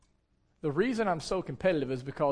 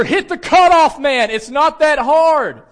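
loud, high, drawn-out shout; yelling; 10 semitones higher, 13 dB louder, slower than usual. Retold or imitated. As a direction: yell at a teammate in exasperation, stretching the words. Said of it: "like, yelling at her".

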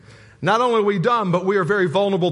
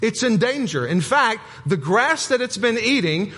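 higher, put-on British accent, playful; mock British accent; 4 semitones higher than usual. Pastor playfully quoting a UK farmer in a British accent. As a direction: slip back into the British video guy's accent. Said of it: "I don't know what accent that is I'm using".

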